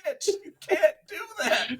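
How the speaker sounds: high laughing protest at his buddy; laughing despair. Retold or imitated. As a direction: crack up while cursing the friend.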